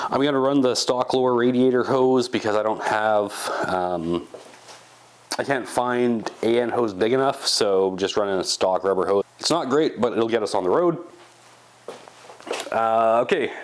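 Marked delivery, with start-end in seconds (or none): none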